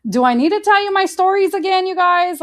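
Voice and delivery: high, loud, hurried mock protest; mock exasperation. Reminding listeners of own running from God, mock-exasperated. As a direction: raise pitch and volume, put out in jest.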